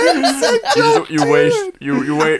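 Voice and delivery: high laughing protest. Real, not acted. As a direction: squeal it through laughter. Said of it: "laughing while accusing".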